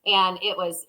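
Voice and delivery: ordinary delivery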